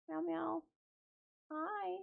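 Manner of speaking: sweet little coo for the kitty. Real, not acted. Cooing at the cat again.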